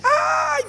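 high, loud drawn-out shriek; imitated excited scream. Woman with her husband spots the doctor, imitated scream. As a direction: let out a fan's piercing squeal. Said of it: "Gritó".

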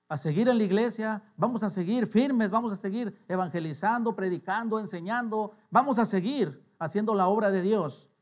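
rally the church item by item; rallying preacher. Acted. Preacher rallies congregation.

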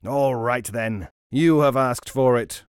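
loud, slow menacing roar; roaring robber. Acted. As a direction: roar it out as a furious threat. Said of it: "roared the robber".

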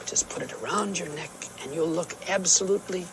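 whispered coaxing aside; soft whisper. Acted. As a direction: murmur it gently, almost breathless. Narrator softly coaxing Dora.

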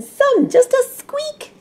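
playful high squeak; squeaky voice. Pretend. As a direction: squeak it like a little mouse. Illustrating a squeaky voice.

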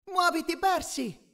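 high, urgent call; pressing, high-pitched exhortation; urging. A narrator's reading. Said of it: "mi esortò Annabeth".

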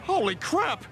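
high, startled exclamation; alarmed disbelief. Acted. Passenger to driver seeing ghosts, alarmed. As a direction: gasp it out in fright. Scared by what chases the truck.

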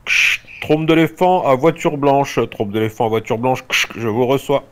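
loud radio call with mouthed static; breaking up space radio. Acted. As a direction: call over a crackling walkie-talkie.

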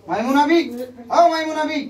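loud, high-pitched summons; calling out. Real, not acted. Healer calling Maimuna to respond, calling out loudly.

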